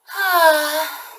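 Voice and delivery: long, high, weary sigh; big sigh. Acted. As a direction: let out a tired end-of-week sigh. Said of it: "I am going to sigh".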